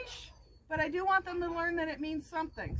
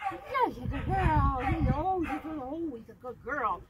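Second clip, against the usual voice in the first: tender praise; 3 semitones lower than usual. Shown as a demonstration. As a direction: coo lovingly to the dog.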